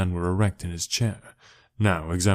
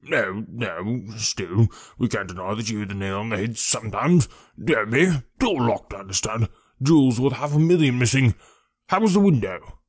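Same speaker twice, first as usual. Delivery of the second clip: husky, self-important inspector, brisk and hearty; pompous detective taking charge; blustering, wheezy police detective. Acted. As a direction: bluster through it in a thick, wheezy voice.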